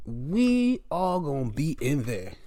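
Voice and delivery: slow, drawn-out declaration; slow repeat. Acted. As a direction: stretch each word out deliberately. Repeats line slower.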